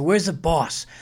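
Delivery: usual speaking voice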